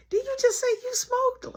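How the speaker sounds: high, incredulous squeak; shocked disbelief. Retold or imitated. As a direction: act stunned she said it. Shocked reaction voiced.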